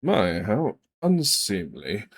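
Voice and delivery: slow, prim, disdainful drawl; thin prim disapproving sniff. Acted. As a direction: sniff at it like a fussy noble. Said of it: "brushes the front of his shirt off".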